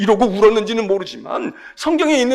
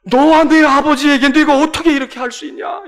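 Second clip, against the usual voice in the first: higher, louder accusing cry; loud reproach; 6 semitones higher, 8 dB louder than usual. Acted. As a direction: hurl the reproach at the son. Mary's reproach voiced loudly.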